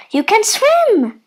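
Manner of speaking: high, loud, delighted cry; excited exclamation. A narrator's reading. Mother Duck seeing the duckling swim, excited exclamation. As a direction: exclaim it with rising pitch.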